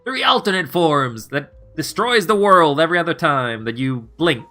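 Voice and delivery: loud, high mock game master. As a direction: belt it out like an over-the-top DM. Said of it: "Your D M is just saying".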